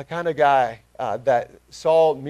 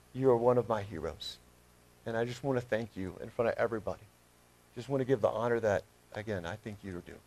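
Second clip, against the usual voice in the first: tender, lowered and softening voice; heartfelt tribute; 3 semitones lower, 3 dB quieter than usual. Real, not acted. Preacher thanks his old youth pastor before the church, sincere and softening. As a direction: settle into quiet, sincere gratitude. Publicly honoring his mentor on stage.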